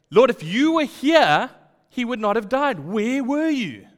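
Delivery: loud, high, slow reproach; accusing question. Retold or imitated. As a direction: demand an answer from him. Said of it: "in a manner of questioning Jesus".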